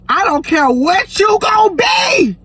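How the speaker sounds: high, drawn-out shout; mock outraged shout. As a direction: shout it like a fed-up parent laying down the law. Voicing his religious family's warning.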